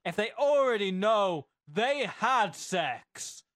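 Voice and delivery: loud, high, drawn-out read-out; hammed-up question reading. Co-host reading a garbled question, hammed-up.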